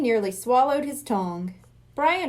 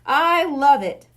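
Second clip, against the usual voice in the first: much louder, slow and relishing; delighted; 2 semitones higher, 7 dB louder, slower than usual. Acted. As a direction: relish every word of it. Hypnotist loves the plan.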